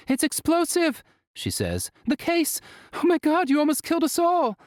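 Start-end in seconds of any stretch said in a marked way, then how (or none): 0.1-1.0 high, frantic cry to stop them; panicked scream. A narrator's reading. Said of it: "Toshio screams for them to stop". 2.1-4.6 high, fast frantic outburst; terrified, scolding in panic; panicked scream. A narrator's reading. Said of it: "Toshio screams for them to stop".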